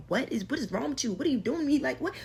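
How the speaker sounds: building alarm as he grabs her; baffled panic. Retold or imitated. Reliving the moment he turned on her, panicked confusion. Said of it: "I was so confused and baffled".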